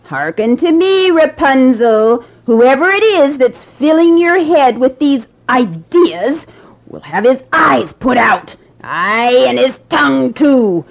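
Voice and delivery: a hag threatening cruelly; angry witch. Acted. Sorceress threatens Rapunzel about her visitor, menacing old crone. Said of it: "Trembling with anger".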